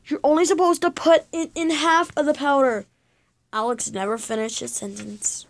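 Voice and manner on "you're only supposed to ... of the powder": raised, high alarmed voice; shouting. Acted. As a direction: yell it at him in alarm. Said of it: "Alex shouted".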